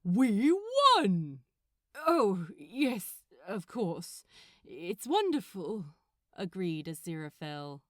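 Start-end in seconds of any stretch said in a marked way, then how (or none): 0.0-1.4 loud triumphant cry. Acted. Gabriel gloats over victory. 2.0-5.8 forced brightness over sadness; hollow forced cheer; forced agreement. Acted. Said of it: "He forced his mouth into a smile".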